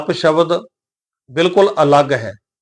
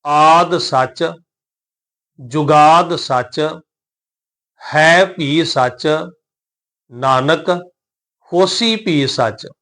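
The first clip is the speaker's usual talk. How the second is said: very slow, drawn-out vowels and a rest after each phrase; recitation with pauses; much slower than usual. Shown as a demonstration. Teacher shows where to rest in the verse, slow paused recitation. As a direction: recite slowly, pausing after every phrase. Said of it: "ਇੱਥੇ ਵਿਸ਼ਰਾਮ ਦੇ ਕੇ ਪਾਠ ਕਰਾਂਗੇ".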